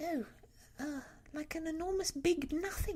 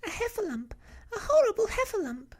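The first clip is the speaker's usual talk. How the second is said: very high, loud alarm squeal; Piglet, panicked cry; 10 dB louder, faster than usual. A narrator's reading. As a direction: cry out in terror in a tiny high voice. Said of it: "cried Piglet".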